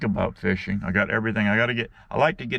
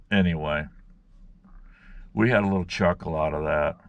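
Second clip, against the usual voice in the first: ordinary delivery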